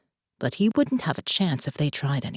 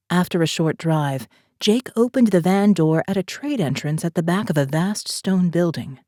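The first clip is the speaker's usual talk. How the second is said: ordinary delivery, nothing marked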